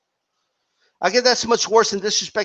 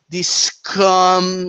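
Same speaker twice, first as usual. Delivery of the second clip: SCUM drawn out with heavy emphasis; imitated emphasis. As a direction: mimic the insult, leaning hard on SCUM. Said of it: "really putting emphasis on scum".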